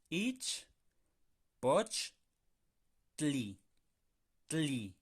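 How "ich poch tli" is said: "Ich, poch, tli" separado con pausas; sílaba por sílaba, poch fuerte. Shown as a demonstration. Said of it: "Vamos a separarla por sílabas". ("Ich" "poch" "tli" separados)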